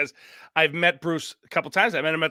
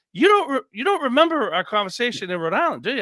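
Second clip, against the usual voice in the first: high, louder teasing "you don't remember"; mock accusing; 8 semitones higher, 4 dB louder than usual. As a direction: play Bruce catching him out, half-joking. Imitates Bruce calling him out.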